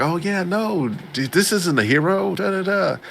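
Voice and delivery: high-pitched brush-off; put-on high voice, covering up; mock dismissive denial. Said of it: "I was like".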